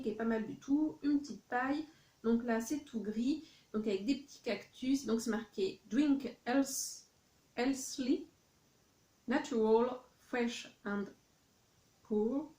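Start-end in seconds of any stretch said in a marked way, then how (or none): 5.9-12.5 English words read in a heavy French accent; self-mocking French accent on English words. Real, not acted. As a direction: sound out the English label the French way. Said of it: "mon accent anglais est toujours magnifique".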